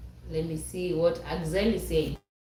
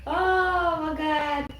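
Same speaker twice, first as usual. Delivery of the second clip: slow amazed oh my God; 9 semitones higher, much slower than usual. Real, not acted. Amused shock at stoner chat.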